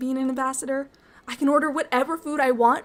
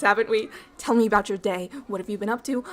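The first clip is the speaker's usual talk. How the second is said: ordinary delivery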